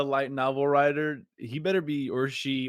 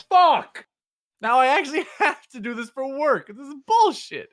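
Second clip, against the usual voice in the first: higher, loud, hammed-up exasperated yell; a mock fed-up shout at suddenly having homework; frustrated outburst; 11 semitones higher, 7 dB louder than usual. Retelling his reaction to a work assignment, mock frustrated yell.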